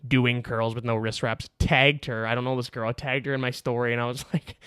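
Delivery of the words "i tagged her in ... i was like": usual speaking voice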